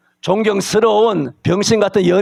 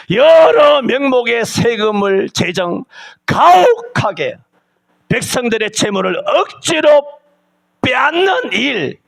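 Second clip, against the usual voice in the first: slow, high, shouted tax list; full-force pulpit shouting, word by word; fiery preaching; 6 semitones higher, slower than usual. Acted. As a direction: hammer each tax name at the congregation. Preaching against taxes.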